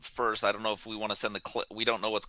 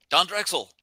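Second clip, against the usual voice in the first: higher, firmer second call; pretend yell; 9 semitones higher, 3 dB louder than usual. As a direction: call out to someone far below. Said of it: "I'll, I'll yell out".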